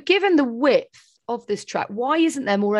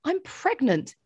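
higher indignant protest; pushing back, offended, higher; 7 semitones higher than usual. Retold or imitated. Pregnant woman protesting to the guard, higher and indignant. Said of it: "I said".

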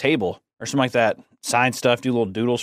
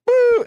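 mock cheer; 6 dB louder than usual. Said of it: "next year it's just gonna be like".

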